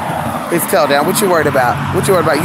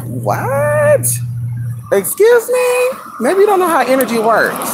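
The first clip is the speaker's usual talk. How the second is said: high, drawn-out indignant what; hammed-up offended squeal; mock outrage; 13 semitones higher, slower than usual. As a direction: act scandalised at the cheek of it. Mock offended reply to the dog.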